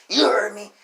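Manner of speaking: loud, high challenge; retold confrontational retort. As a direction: throw it back loudly, imitating an aggressor. Frat boy squares up, retold.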